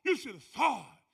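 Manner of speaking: normal talking voice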